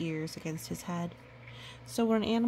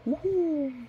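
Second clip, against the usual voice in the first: high, loud hooting owl call; 9 semitones higher, 5 dB louder than usual. Acted. Reading to a child, a loud high owl hoot. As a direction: hoot it out like a great gray owl.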